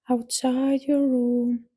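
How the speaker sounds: normal talking voice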